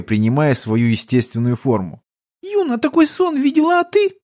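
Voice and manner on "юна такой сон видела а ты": put-on very high childish voice; little girl. Little girl telling Igor of her dream, squeaky. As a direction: pipe it up like a small child.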